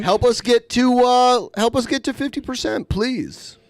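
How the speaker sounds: high, loud whiny plea; mock begging. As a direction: whine for donations in a squeaky voice.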